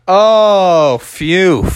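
loud, long sigh of relief; big relief. Acted. Crowd learns it's not chicken pox, loud relief. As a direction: let out an exaggerated phew.

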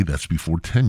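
ordinary delivery

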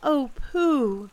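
put-on high, loud boyish cry of worry; distressed child calling out; anguished cry. A narrator's reading. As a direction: cry out for a missing friend. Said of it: "cried Christopher Robin".

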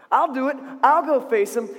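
high, loud, eager declaration; bold volunteering. Acted. David volunteers to face Goliath, loud and bold. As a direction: step up and announce it boldly. Hero volunteers again, emphatically.

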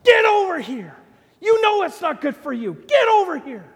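high, loud, urgent call; imitated yelling father. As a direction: yell as a father calling a child away from danger. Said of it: "the Father's yelling at us".

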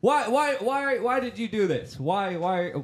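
loud, high-pitched mock-indignant demand; mock outrage. Host jokingly blames British guest for his government, mock outrage.

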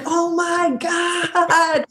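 high, very drawn-out mock gasp of awe; imitated amazed reaction. Actress retelling her brother's reaction, imitated amazement. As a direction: stretch it out slowly, way up high.

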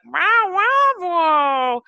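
high, loud yowling cat meow; talking meow. Retold or imitated. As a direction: mimic a cat complaining in the car. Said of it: "talking meow".

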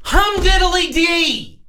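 very high, slow mock celebration; sarcastic. Frustrated fan quoting the montage after a loss, sarcastic. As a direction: draw out the catchphrase in high-pitched sarcasm. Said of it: "to quote the montage, sarcastically".

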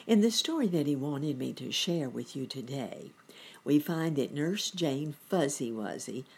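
ordinary delivery, nothing marked

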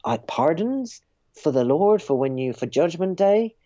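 shady relic seller's sales patter; relic peddler. Acted. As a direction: dangle Christ's finger bones like prized stock.

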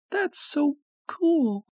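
put-on very high, slow sleepy girl; drowsy, drifting little girl; half asleep. A narrator's reading. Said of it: "She sounded half asleep".

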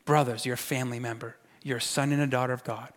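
very soft, low preaching voice; tender address. Acted. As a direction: hush right down to reassure the listeners as family. Preacher tenderly addressing congregation as family.

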